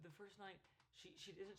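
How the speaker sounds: ordinary delivery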